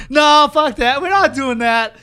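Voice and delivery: shouted refusal, high and loud, easing off by the end; Moxley's defiant refusal. Retold or imitated. Co-host re-enacting Moxley refusing the stadium match, loud defiant shout. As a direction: yell it out high, then let it settle. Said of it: "And then Moxley, the way, exactly".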